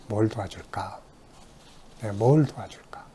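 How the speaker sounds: soft, hushed kindly question; gentle offer of help. Retold or imitated. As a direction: welcome a nervous child quietly. Counselor greeting a child gently. (아이에게 부드럽게 속삭이듯)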